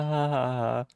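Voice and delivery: ordinary talk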